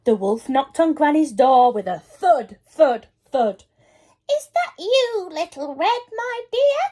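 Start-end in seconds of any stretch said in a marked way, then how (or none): 4.3-6.8 high, quavery granny voice; old granny calling. A narrator's reading. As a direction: call through the door like a granny. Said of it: "called Granny".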